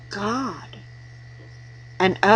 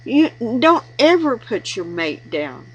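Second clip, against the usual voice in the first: ordinary talk, nothing marked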